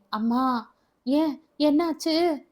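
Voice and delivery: high, drawn-out cry of pain. Acted. Arumugam waking in agony, crying out in pain. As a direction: call out in sudden pain.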